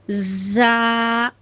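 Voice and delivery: زا with a long buzzing z at the start. Shown as a demonstration. Teacher repeats the buzzing letter for students. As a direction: let the z of زا buzz audibly. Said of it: "زا کا سمجھ میں آ گیا".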